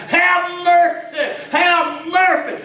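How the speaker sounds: high, slow desperate cry; crying out for mercy. Retold or imitated. Desperate mother crying to Jesus for mercy. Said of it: "she's crying".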